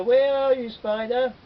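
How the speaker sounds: loud, high call; boy shouting for Spider. Acted. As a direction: call out searching in the bush. Boy calls into the bush.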